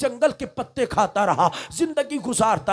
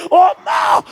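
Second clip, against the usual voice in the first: anguished scream, much higher and louder; 8 semitones higher, 8 dB louder, slower than usual. Acted. Son screams to his mother after cutting off his hand, in pain. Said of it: "بیٹا چیخ کر کہتا ہے".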